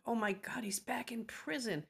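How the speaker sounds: retold panic in a breathless whisper; hushed, frightened whisper; panicked whisper. Mother hearing her son's call came from prison, panicked whisper. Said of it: "and I panicked".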